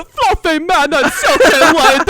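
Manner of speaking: slow laughing cartoon voice; Ed accent. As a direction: giggle out the silly cat names. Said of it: "what else we say in that accent".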